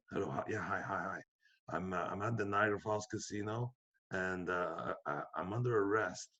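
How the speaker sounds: low, soft, hesitant caller; hushed phone call. Retold or imitated. Calling 911 from the casino police station, hushed. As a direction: speak softly into the phone, acting it out.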